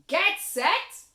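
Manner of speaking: loud, drawn-out starting call; slow booming starter's call; race starter shout. Acted.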